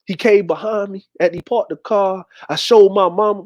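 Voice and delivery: usual speaking voice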